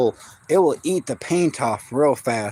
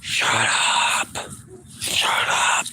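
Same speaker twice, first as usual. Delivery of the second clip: hushed shut up; 4 dB quieter, much slower than usual. Real, not acted. Hushing barking dog.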